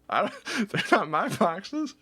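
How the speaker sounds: usual speaking voice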